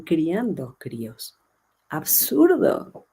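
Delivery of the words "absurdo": slow, drawn-out and much higher "Absurdo"; scornful. Real, not acted. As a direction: stretch "Absurdo" out high in disbelief. Scornful verdict on parenting. ("Absurdo" lento, agudo y burlón)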